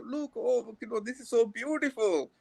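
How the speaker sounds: proud locals marvelling, lightly voiced and teary; tearfully moved praise. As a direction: gush over a foreigner's Tamil as if near weeping. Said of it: "they'll cry".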